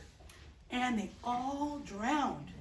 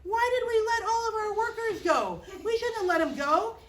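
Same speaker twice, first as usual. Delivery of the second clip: the Egyptians whining to each other; Egyptians regretting; 9 semitones higher than usual. Acted. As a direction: grumble as if you lost your whole workforce. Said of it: "they said".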